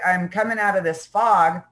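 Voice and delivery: usual speaking voice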